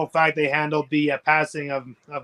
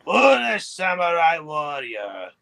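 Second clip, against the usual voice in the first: slow, lightly put-on Aku voice; Aku impression; 4 semitones higher, 3 dB louder, slower than usual. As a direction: say it slowly like Aku. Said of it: "go to the character of, uh, Aku".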